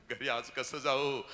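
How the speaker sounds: normal talking voice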